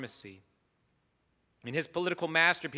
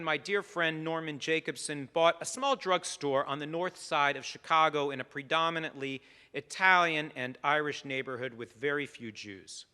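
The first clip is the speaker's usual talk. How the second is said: ordinary talk, nothing marked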